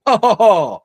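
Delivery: loud, high mock guffaw; sarcastic fake laugh. Streamer scoffs at the guest's argument with a fake laugh.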